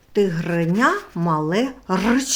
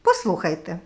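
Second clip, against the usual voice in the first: ordinary talk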